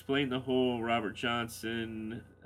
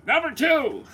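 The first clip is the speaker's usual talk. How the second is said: high shouted "Number two!"; loud, high mock chant of the guys; mock crowd shout; 15 dB louder than usual. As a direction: yell it like the guys used to. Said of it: "I missed the guys going".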